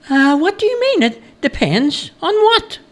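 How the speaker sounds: high-pitched questioning traveller; puzzled stranger. A narrator's reading. Stranger querying the woodcutter's answer, puzzled character voice.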